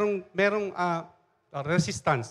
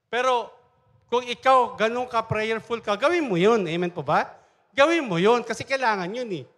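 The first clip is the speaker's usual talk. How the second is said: louder, higher exhortation to the congregation; preaching; 6 semitones higher, 6 dB louder than usual. Acted. Pastor urging the church to pray, louder and higher. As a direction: urge them on like a preacher.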